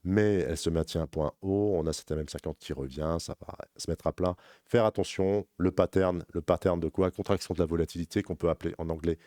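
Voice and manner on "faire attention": usual speaking voice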